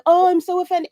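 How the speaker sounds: high, sarcastic mock outrage; hammed-up fake indignation; mock offended. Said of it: "You'll be like".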